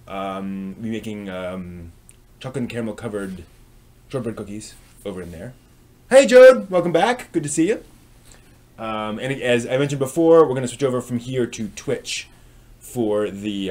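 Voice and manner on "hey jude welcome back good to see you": excited greeting. Real, not acted. Greets returning viewer in chat.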